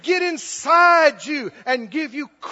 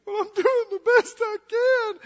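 much higher, mock-crying wail; hammed-up tearful protest; self-pitying whine; 10 semitones higher than usual. Preacher mimics the weak believer protesting to a critic, mock-crying whine. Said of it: "or you wouldn't be talking like that".

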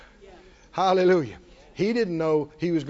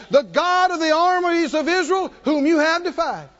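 bold defiance; 9 semitones higher, 5 dB louder than usual. Retold or imitated. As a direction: proclaim it as the Lord's champion. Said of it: "David said to the Philistine".

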